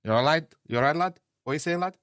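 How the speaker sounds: high put-on northern "lad"; imitating northern greeting. Host retelling how locals greet him, put-on northern accent. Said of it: "they call me".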